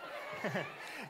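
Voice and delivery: soft run of giggles; chuckle. Real, not acted. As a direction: quietly giggle at the dog's antics. Amused by the silly dog.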